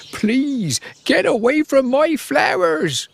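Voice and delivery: high-pitched yell protecting his flowers; shouting. A narrator's reading. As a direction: cry out high to shoo them off. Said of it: "he shouted very loudly".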